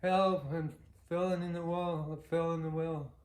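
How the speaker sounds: a retold, hammed-up cry for help. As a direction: yell up from the well in mock panic. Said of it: "and he cried out".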